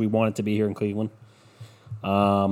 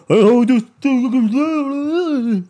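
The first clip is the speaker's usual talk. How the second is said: high, slow, hammed-up fan voice; mock sports fan; 13 semitones higher, 7 dB louder, much slower than usual. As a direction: squeal it out like an overeager fan. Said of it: "then everyone comes to me".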